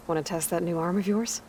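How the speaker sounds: usual speaking voice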